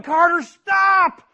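loud, high pleading shout; father calling his toddler back from the road; yelling. Retold or imitated. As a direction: shout to make him stop, then coax. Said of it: "I'm yelling".

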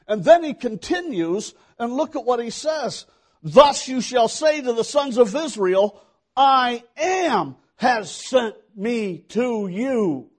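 high, loud scripture proclamation; full-force preaching. Acted. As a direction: proclaim it to the whole congregation.

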